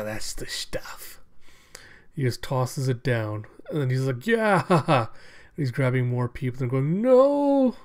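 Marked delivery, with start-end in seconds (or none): 0.0-1.2 slow, softer, hushed relish; blissful junkie brute. Acted. Brute gets his brain fix, blissful hushed satisfaction. 4.3-5.1 high, loud yell breaking into cackle; manic villain whoop. Acted. Brain junkie tosses down a victim, manic whooping laugh. Brute's manic cry in retelling. 7.0-7.8 long, very high, loud "no"; terrified scream. Retold or imitated. Victims run from the brute, terrified acted scream.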